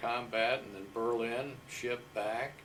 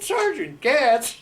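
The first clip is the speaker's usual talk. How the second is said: much higher and louder, a startled cry of the name; startled shout; 16 dB louder, slower than usual. Retold or imitated. Old sergeant startled by a voice from the past, shouting the name. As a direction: jump out of your skin and yell the name back. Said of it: "he jumped out of the chair".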